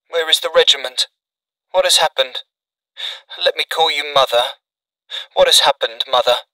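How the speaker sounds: a hint of an anxious son in bed; weak wounded son. A narrator's reading. Wounded son questions mother.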